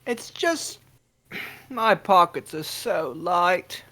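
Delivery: high Cockney urchin voice; pleading Cockney street kid; old london cockney. Acted. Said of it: "Cockney boy".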